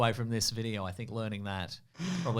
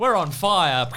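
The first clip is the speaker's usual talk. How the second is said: excited boast; 5 semitones higher, 9 dB louder, much slower than usual. Real, not acted. Celebrating the win.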